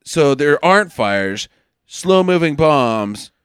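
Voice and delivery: slow, low, deliberate musing; slow realisation. Retold or imitated. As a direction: piece it together aloud, word by word. Acting out the hero's slow realisation.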